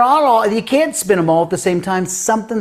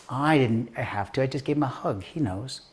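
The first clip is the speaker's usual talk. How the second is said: put-on quiet child; 2 semitones lower, 8 dB quieter than usual. Girl explaining to her dad, quiet and matter-of-fact. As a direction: answer softly as the little girl.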